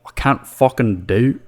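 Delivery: slow Irish attempt that lands in Scottish; attempted Irish sounding Scottish. Shown as a demonstration. Host retrying an Irish accent he can't do, sounding Scottish. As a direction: aim for Irish, hit Scottish instead. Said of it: "Yeah, that's Scottish".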